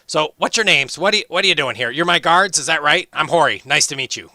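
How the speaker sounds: high, loud, eager dwarf voice; chatty young dwarf. Acted. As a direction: bounce in as the cheerful young dwarf. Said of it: "He says".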